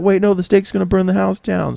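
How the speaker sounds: high, hurried mock panic; panicked brother. Brother panics over burning steak.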